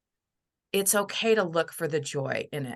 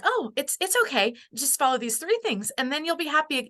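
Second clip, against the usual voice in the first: higher, breezy mock cheerfulness; mock chirpy reassurance; 8 semitones higher than usual. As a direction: brush off their pain like a cheerful know-it-all. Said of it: "don't wanna be like".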